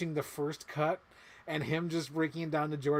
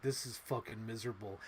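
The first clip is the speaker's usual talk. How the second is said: grumbling; 5 semitones lower, 7 dB quieter than usual. Retold or imitated. Quoting grumbling producer.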